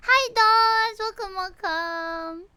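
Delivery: high, loud hello stretching into slow welcomes; excited greeting. Real, not acted. Streamer welcoming new arrivals, excited drawn-out greeting. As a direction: call out high, then draw it out.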